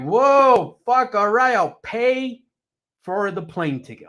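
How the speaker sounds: high, loud fed-up give-in; exasperated outburst. Retold or imitated. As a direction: throw up your hands, high and loud. Retold giving in during fight.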